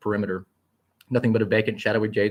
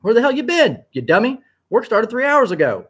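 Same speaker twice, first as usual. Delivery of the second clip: much higher, loud bossy scolding; scolding secretary; 13 semitones higher, 8 dB louder than usual. A narrator's reading. Secretary Bertha scolds Onyx for being late, loud and high. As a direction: tell off the late boss.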